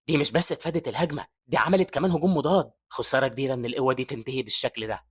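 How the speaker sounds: plain reading voice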